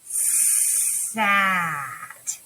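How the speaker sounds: stretched sat, each sound joined; teacher modelling a blend; blending all sounds. Shown as a demonstration. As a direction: say it slowly, sound by sound. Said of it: "you guys do that with me".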